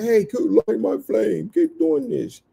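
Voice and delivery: a silly high voice for the object talking back; high mock object voice. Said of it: "He can't say".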